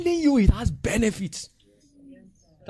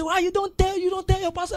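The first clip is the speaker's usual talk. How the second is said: mock questioner; faster than usual. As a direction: scoff at them. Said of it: "Somebody say".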